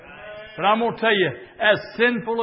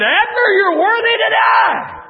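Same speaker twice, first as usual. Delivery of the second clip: crying out; 9 dB louder than usual. Acted. David rebuking Abner across camp.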